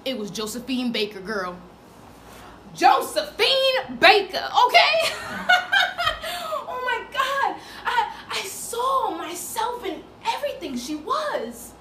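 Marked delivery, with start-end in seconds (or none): 2.7-11.7 loud and high, laughing through tears; ecstatic. Acted. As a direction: overcome by meeting your idol. Overwhelmed rapture.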